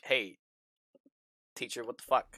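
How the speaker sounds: low-key mock challenge to teacher; retold challenge. Player recalling their group questioning teacher, low-key mock challenge. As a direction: toss it off low and soft, retelling. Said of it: "we've been like".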